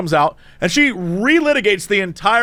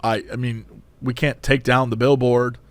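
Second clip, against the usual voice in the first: low, hesitant cashier; drive-through guy; 8 semitones lower than usual. Retold or imitated. Cashier answering an angry customer, low and subdued. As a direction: imitate a subdued employee, pitched down. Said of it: "He's like".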